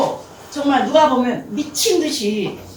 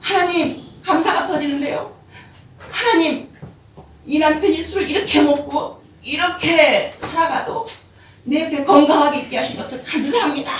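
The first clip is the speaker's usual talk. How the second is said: higher, tearful cry of thanks; weeping thanks; 5 semitones higher, 4 dB louder than usual. Retold or imitated. Wife holding drunk husband, tearful thanksgiving. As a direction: sob out gratitude. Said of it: "안고 통곡을 합니다".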